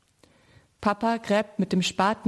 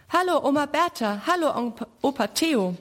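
calling out gladly; 6 semitones higher than usual. A narrator's reading. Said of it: "ruft Sammy".

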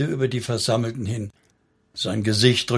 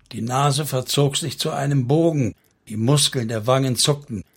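plain reading voice

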